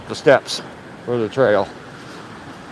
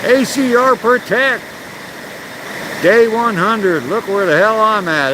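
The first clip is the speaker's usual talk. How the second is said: pitched-up, stretched-out hello; excited shout-out; 8 semitones higher than usual. Real, not acted. Calling out to arriving livestream viewer.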